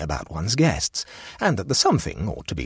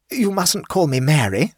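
high, flustered society hostess; shocked hostess; 10 semitones higher, 5 dB louder than usual. Acted. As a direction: protest like a scandalised hostess. Voices the hostess.

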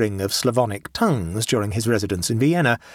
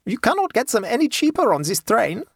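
put-on high German-accented matter-of-fact haggler; unyielding peasant trader; relentless logic; 11 semitones higher than usual. A narrator's reading. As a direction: state it implacably, pitched high. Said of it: "with a relentless logic".

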